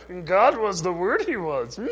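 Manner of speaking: a hint of Yoda's backward phrasing; Yoda talk. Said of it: "that sounds more like Yoda talk".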